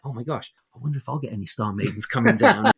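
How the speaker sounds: a lightly voiced excited farmer. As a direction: gasp it out as a thrilled farmer. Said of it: "this farmer's like".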